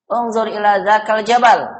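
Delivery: high pitch all through to JABAL, then a drop; command intonation. Shown as a demonstration. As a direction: order it, lifting jabal high before the fall. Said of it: "Begitu ya. Dinaikkan ke atas".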